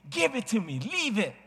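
put-on high, whiny child voice demanding the ball; imitating child. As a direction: voice the boy pulling the ball.

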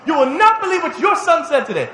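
high and loud, leaning on not; indignant tattling mother, laughing. Retold or imitated. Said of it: "Dad gets home".

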